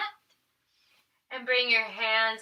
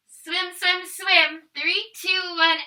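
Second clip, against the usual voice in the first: higher, louder pumped-up count; energetic coaching; 6 semitones higher, 7 dB louder than usual. Real, not acted. Yoga teacher pumping up kids kicking like swimmers. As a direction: cheer them through the swim. Energetic swim exercise cue.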